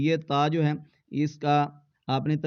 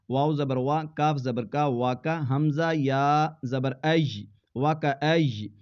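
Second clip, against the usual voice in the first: ordinary delivery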